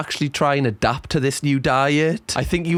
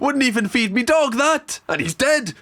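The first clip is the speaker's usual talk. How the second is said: higher, hammed-up moan about dinner; mock pupil moaning; 9 semitones higher than usual.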